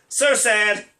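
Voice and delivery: mock sorrow as a punchy exclamation; exclaimed sadness. Comic repeating the tweet as a punchline, mock exclamation. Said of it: "exclamation point".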